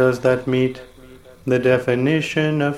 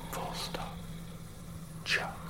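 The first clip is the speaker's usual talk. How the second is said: punctuation breathed in a whisper; whispered dictation; 19 dB quieter than usual. Acted. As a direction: whisper the punctuation marks aside. Reading punctuation aloud.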